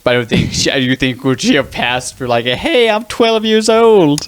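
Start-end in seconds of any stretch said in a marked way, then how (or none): 2.5-4.3 high, drawn-out pretend kid voice; mock twelve-year-old. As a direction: put on a squeaky-high child voice, slowly. Mock voicing an adult passing as twelve.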